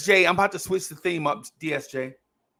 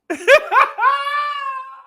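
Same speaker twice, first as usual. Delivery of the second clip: loud shrieking laugh; 7 dB louder than usual. Real, not acted. Host cracks up at his own impression, shrieking laugh. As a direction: burst into a high howl of laughter.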